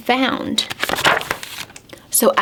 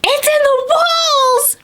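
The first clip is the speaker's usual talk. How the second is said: high-pitched owl screech. A narrator's reading. Storyteller voices angry Owl, a high screech. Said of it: "Owl screeched".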